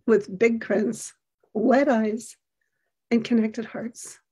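ordinary talk

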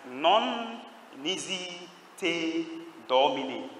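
Latin words drawn out one by one; slow intoned recitation. Shown as a demonstration. Preacher gives the saint's Latin answer, slow intoned recitation. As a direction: stretch each word out slowly for the congregation.

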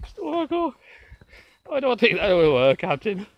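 hammed-up Irish that has turned Scottish; accent gone Scottish. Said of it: "you've gone Scottish instead of Irish".

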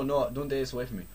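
normal talking voice

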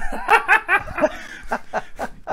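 big burst of real laughter; a loud high cackle of laughter. Real, not acted. Bursts out laughing at own joke.

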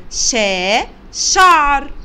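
loud شا on its own, then the word شعر; sounding out. Shown as a demonstration. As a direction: model how شعر begins with شا. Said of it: "بيبدأ بصوت شا".

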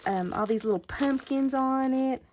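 ordinary talk

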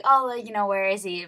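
loud, eager crowd reaction; excited crowd. Retold or imitated. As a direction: imitate a crowd clamoring to look.

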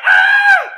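very high, loud mock scream; hammed-up fright shriek. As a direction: shriek as if a bear is chasing you. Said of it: "jump away ... and go".

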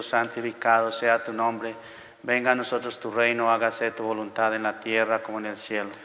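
normal talking voice

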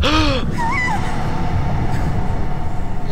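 sharp terrified gasp; startled gasp. Real, not acted. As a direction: gasp in sudden fright. Jump scare.

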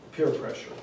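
normal talking voice

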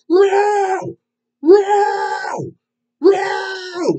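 high, drawn-out "row" howl; long high-pitched yowl between songs; loud obnoxious cheer. Retold or imitated. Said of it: "like, really loud and echoed".

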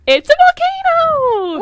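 high, slow, big reveal; excited reveal. Acted. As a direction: unveil it like a game show. Surprise reveal to co-hosts.

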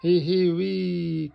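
high, loud, drawn-out squeal of glee; long storybook whee; gleeful cry. A narrator's reading. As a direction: stretch it out like sliding down. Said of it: "cries George".

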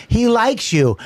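mock a weird adoption pitch; weird shelter worker. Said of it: "those people are weird".

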